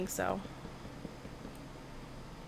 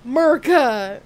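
patriotic cheer; 8 dB louder than usual. Acted.

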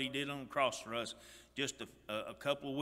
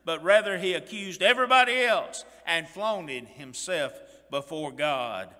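loud, impassioned pulpit delivery, pushing higher; fiery preaching; 3 semitones higher, 8 dB louder than usual. Acted. Preacher to congregation, loud impassioned sermon. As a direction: preach it hard to the whole congregation.

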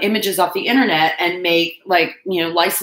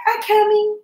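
voicing the cat; 3 dB louder than usual. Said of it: "Loki's like".

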